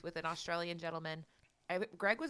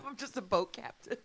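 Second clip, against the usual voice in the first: put-on laughing, failed British accent; a botched British voice, giggling through; bad British accent; 4 dB quieter than usual. After being told the accent was off, a laughing bad British accent. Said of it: "You're so far away from British".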